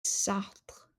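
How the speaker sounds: Sartre said the French way, whispered; French accent. Shown as a demonstration. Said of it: "that's with a French accent".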